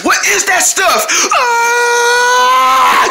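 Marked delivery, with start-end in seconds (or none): 1.4-3.1 high loud scream; startled scream. Acted. Toy panicking at the weird spray, startled scream. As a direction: scream in sudden alarm.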